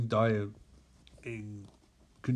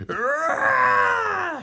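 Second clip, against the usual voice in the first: high, loud raging scream; enraged roar; 7 dB louder than usual. Acted. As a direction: let out a drawn-out roar of fury. Mera filled with rage.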